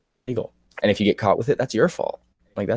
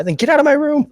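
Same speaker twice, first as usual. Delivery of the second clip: hammed-up shout, far higher and louder; mock shout; 5 dB louder than usual. Kid ordering sober mom out of the room, mock shout. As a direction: throw mom out in mock outrage.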